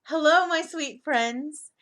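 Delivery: put-on slow, high, sweet hello to children; warm greeting. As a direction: greet the little ones fondly. Greeting children on reading show.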